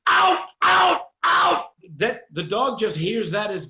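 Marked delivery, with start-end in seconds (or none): 0.1-1.7 high, loud barked out command; yelling the release command in a frenzy; retold shouted command. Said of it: "he goes".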